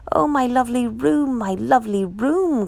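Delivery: a lightly voiced woman lamenting her room; crying out. A narrator's reading. Woman dismayed at her painted room, crying out. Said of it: "cried the woman".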